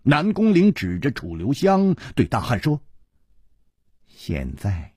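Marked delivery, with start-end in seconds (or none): 4.1-4.9 low, soft command; quiet menace. Acted. Villain points henchman at the enemy, quiet menace. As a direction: begin softly, in full control.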